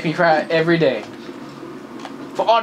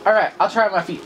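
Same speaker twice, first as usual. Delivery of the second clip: normal talking voice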